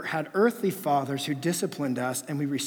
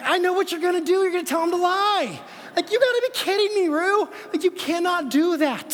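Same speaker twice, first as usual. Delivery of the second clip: much higher, louder, fast disbelieving outburst; blowing up at a scheming kid; exasperated dad; 9 dB louder than usual. Retold or imitated. Dad catching daughter's scheme.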